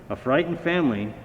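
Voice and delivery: ordinary delivery, nothing marked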